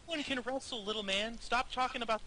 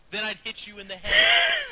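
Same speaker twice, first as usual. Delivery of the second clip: ordinary talk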